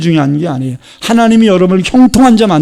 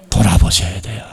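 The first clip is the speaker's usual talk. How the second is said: ordinary talk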